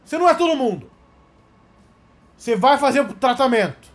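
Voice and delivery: hammed-up angry father voice; mock furious father. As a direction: play the fed-up dad yelling, high-pitched. Father yells at son, retold.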